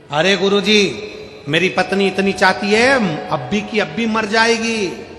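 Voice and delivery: mildly hammed-up appeal; mild pleading. Acts out man pleading with guru.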